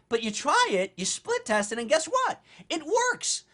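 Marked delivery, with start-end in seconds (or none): 1.8-3.4 spring the surprise on them, high and bright; high-pitched "ta-da" reveal; excited reveal. Real, not acted. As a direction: pitch jumps way up for the payoff.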